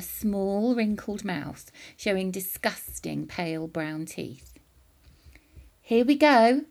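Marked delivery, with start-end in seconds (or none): 5.8-6.7 louder, higher eager call; louder excited boy's call; cried out. A narrator's reading. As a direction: announce it with glee. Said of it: "George cried out".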